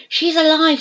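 high, loud shout from the crowd; a bystander shouts the news. A narrator's reading. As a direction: call it out to everyone. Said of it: "someone shouted".

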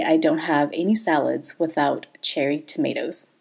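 ordinary talk

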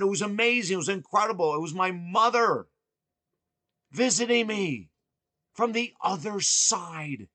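breathless, thrilled retelling to his partner; overjoyed exclaiming. Retold or imitated. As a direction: gush out the amazing news. Telling partner about seeing mother.

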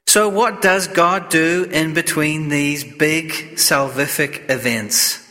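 normal talking voice

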